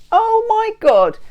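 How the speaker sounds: high, loud, drawn-out oh my God; astonished excitement. Real, not acted. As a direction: exclaim slowly and high in amazement.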